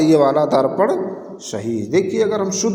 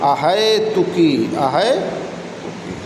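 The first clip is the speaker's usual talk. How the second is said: slow model word; much slower than usual. Shown as a demonstration. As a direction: repeat अहेतुकी slowly like a teacher. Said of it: "शुद्ध रूप है अहेतुकी". (अहेतुकी धीरे-धीरे दोहराइए)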